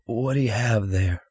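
lightly voiced raspy stranger; a touch of dry rasp. A narrator's reading. Tired stranger asking children about their box, lightly dry and raspy. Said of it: "His voice was dry and raspy".

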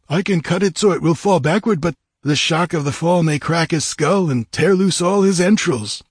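high-pitched put-on character voice; objecting nomad. A narrator's reading. Nomad explaining the danger of felling the cross, objecting.

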